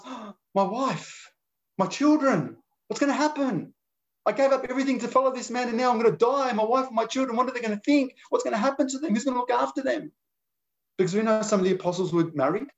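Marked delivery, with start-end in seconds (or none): 0.0-10.1 anxious disciple. Retold or imitated. Preacher voicing panicked disciple.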